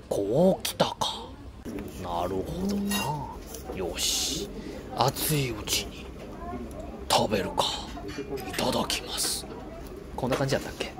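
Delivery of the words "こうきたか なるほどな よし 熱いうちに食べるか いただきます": lightly voiced gourmet inner monologue; quiet, slow musing over food; Goro narration voice. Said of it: "五郎さんのナレーションってこんな感じやったっけ".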